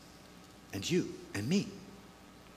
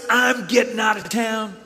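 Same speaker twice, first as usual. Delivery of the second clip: excited son; 5 semitones higher than usual. Acted. Son announcing he is leaving.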